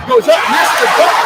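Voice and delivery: high, loud shout with a laugh; thrilled announcer shouting the miss; excited shout. Real, not acted. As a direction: yell the missed dunk in disbelief. Missed dunk at game climax.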